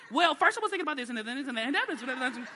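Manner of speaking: put-on excited kid rambling.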